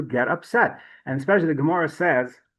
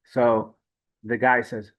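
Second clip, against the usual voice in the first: ordinary delivery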